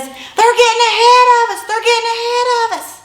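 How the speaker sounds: high urgent yell, eyes forward. Retold or imitated. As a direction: shout it, desperate to win. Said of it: "he just yells while he's looking forward".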